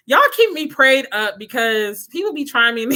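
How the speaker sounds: usual speaking voice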